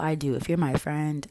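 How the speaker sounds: ordinary talk, nothing marked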